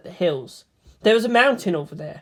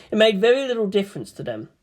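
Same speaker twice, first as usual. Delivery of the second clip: ordinary delivery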